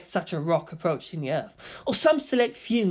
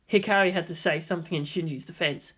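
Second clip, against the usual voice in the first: ordinary delivery, nothing marked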